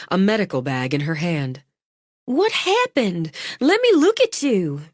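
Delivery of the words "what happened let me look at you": high, urgent worried medic; alarmed woman. A narrator's reading. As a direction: rush in pitched high with concern. Medic runs up to injured friend.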